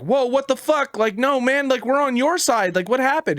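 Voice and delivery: high-pitched, alarmed mock protest; protesting goblins. Goblins protesting the boss's kill order, mock panic.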